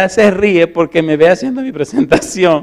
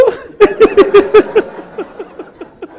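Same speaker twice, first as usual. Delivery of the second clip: high hearty burst of laughter; big laugh; 4 dB louder than usual. Real, not acted. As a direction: laugh out loud freely. Speaker laughing at his answer.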